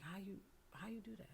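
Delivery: whispered, faltering question; hushed disbelief. Retold or imitated. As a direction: ask it under your breath, barely voiced. Asking her father how he left.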